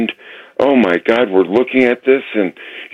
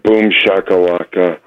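total dejection. Real, not acted. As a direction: drag it out, utterly devastated. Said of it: "the saddest boom shakalaka I've ever heard".

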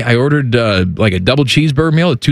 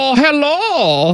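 put-on German accent; 5 semitones higher, slower than usual. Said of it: "don't you do my German accent".

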